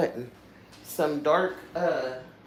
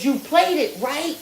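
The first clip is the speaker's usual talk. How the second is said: high, loud, excited yelling; hollering; 12 semitones higher, 4 dB louder than usual. Retold or imitated. Aunt on the phone to her niece, hollering excitedly. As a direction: holler it like you can't believe your luck. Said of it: "And she hollered".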